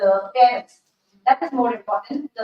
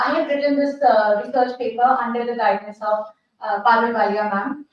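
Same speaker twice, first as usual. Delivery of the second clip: normal talking voice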